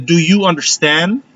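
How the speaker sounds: slow, clear "Do you understand?" with a rise; slow teacher question. Shown as a demonstration. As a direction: ask it slowly, as if to a class. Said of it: "Cuando yo le hago la pregunta".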